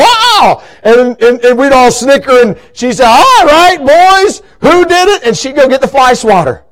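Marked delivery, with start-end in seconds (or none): 0.0-0.6 high-pitched "Ah!" on bumping into something; startled yelp. Retold or imitated. Grandma bumps into moved furniture, startled yelp. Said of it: "you hear go". 3.1-5.2 raised-pitch imitation of a cross grandma; scolding grandma. Grandma confronts her snickering grandsons, mock scolding.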